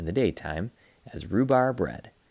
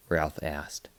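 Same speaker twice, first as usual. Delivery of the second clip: plain reading voice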